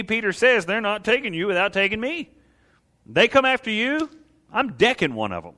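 high, driving pulpit voice; fired-up preaching. Real, not acted. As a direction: preach it with rising force, punching the key words. Preaches at full force.

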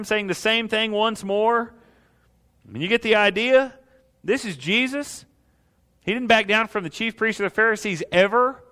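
high, driving pulpit voice; fired-up preaching. Real, not acted. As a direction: preach it with rising force, punching the key words. Preaches at full force.

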